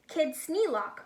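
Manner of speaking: usual speaking voice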